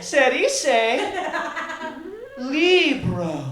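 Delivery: put-on high, slow teacher-to-toddler voice; mock Dora the Explorer, high and patient; wife teaching like Dora. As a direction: spell it out like a kids' show host. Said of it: "And she's like".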